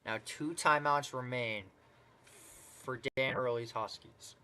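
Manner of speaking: brisk, rising basketball call; live play-by-play. Acted. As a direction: call the game as it unfolds.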